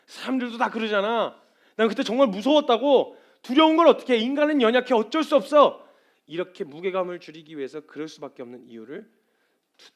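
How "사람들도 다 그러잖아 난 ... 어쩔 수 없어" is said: loud, high defensive outburst; protesting excuses. Acted. Failed believer justifying human weakness, loudly defensive. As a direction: plead your excuse at mounting volume.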